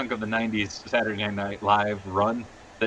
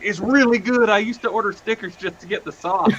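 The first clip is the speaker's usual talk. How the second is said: excited gushing; 9 semitones higher, 6 dB louder than usual. Retold or imitated. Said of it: "And Billy's like".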